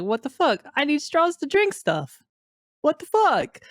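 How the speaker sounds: hammed-up fed-up complaint; mock outrage.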